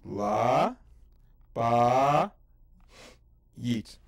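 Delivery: loud sounding out Ba, La, Bayit with pauses; syllable by syllable. Shown as a demonstration. Teacher sounds out Bayit syllable by syllable, loud and slow. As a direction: break Bayit into syllables aloud. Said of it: "Three syllables".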